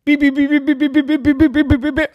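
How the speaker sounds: high loud beeping alarm; car alarm imitation. Car alarm after hit.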